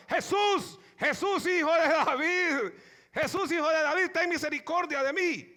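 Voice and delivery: loud pleading shout to Jesus; calling out. Retold or imitated. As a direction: cry out for mercy across the crowd. Blind beggar calling out.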